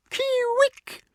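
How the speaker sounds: very high, slow challenging Kee-wick; angry call. Shown as a demonstration. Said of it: "angrily in challenge or in hate".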